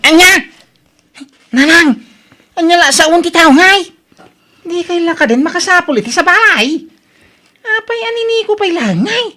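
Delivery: shocked protest. Acted. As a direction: cry out in disbelief at mother. Daughter shocked at mother's remarriage.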